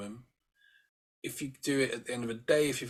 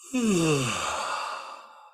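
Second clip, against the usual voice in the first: long loud yawn falling away; a big open yawn, let it drop; demo yawn; 5 semitones higher, 6 dB louder than usual. Shown as a demonstration. Coach showing listeners how to yawn, loud long yawn. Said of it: "moving your tongue".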